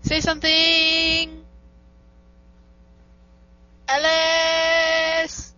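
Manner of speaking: loud, long drawn-out calls; calling out. Real, not acted. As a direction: holler down a bad line, stretching the words. Calling to Alice who can't hear.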